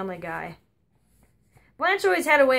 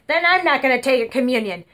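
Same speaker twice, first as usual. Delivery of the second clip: louder indignant retort; defiant grandma; 5 dB louder than usual. Retold or imitated. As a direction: put the nun in her place.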